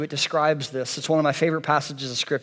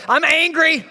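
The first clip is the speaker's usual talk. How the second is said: high, loud angry outburst; mock childish angry yell; angry kid; 13 semitones higher, 7 dB louder than usual. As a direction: play a kid blurting out fury, pitched way up. Acted kid yelling at parent.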